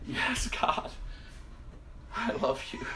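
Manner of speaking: high, soft, breath-filled reply; hushed answer. Acted. Believer finally answering God, hushed.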